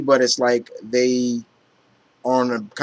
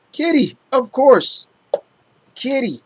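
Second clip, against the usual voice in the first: delighted; 12 semitones higher than usual. Real, not acted. Delight continuing over Kitty.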